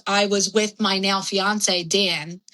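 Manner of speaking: ordinary talk, nothing marked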